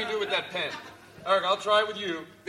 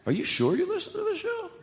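mock high voice; 10 semitones higher, 3 dB louder than usual. Mocking heckler in comedy bit.

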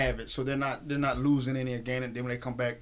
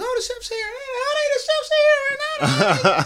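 very high, drawn-out excited squeal; hammed-up squealing welcome; mock excited greeting; 9 dB louder, slower than usual. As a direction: imitate people fussing over the chef's arrival, high and loud. Voicing people greeting the chef.

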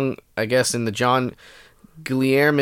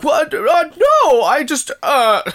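high, loud caught-out stammer; panicked denial, much higher; flustered; 13 semitones higher, 5 dB louder than usual. Retold or imitated. Dad caught watching porn, flustered.

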